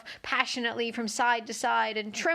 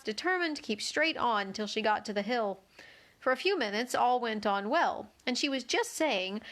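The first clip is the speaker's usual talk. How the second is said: ordinary delivery, nothing marked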